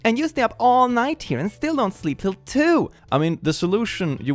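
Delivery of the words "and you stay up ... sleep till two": high, whiny worried mother; anxious mom voice. Retold or imitated. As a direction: complain like a fretting mom. Reading mom's reply.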